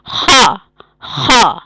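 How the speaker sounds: "خا" with a strong rasped kh from the throat; heavy, from the throat. Shown as a demonstration. Teacher demonstrating heavy letters for students, throaty "خا". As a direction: push "خا" out hard and heavy, long vowel. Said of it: "ان کو ہم حلق سے آواز نکالیں گے".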